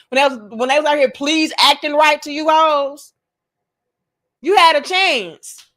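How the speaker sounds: rising to a loud scold. Real, not acted. Scolding the women who ignored her, loud. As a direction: drive the point home loudly.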